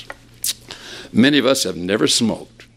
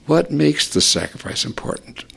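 ordinary talk

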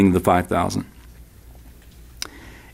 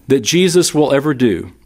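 normal talking voice

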